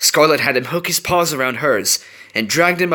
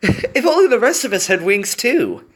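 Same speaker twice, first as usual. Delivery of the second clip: laughing; 11 semitones higher than usual. Acted. Group laughing at joke.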